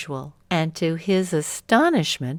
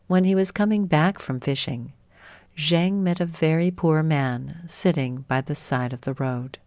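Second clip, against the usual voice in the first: usual speaking voice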